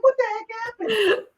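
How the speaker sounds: high, alarmed outcry; mock panic at seeing the dog; shocked alarm. Seeing the neighbour bring her dog back, high alarmed outcry. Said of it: "I'm like".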